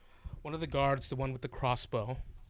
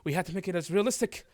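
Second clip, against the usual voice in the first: higher, louder fussy author voice; smooth over it like a guilty showman; author excusing himself; 4 semitones higher, 6 dB louder than usual. Acted. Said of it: "he goes".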